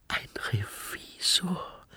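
whispered, slow question on a breath. A narrator's reading. Susanne realising who faces her, breathed in a slow whisper. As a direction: breathe it out in hushed disbelief. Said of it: "hauchte Susanne".

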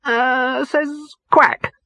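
high rabbit voice, faltering; high bunny losing his words; wavering rabbit. Acted. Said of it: "he wavered".

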